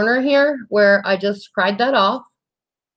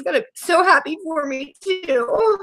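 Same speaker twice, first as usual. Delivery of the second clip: choked up, happy tears; 7 semitones higher than usual. Real, not acted. Fighting tears over good news.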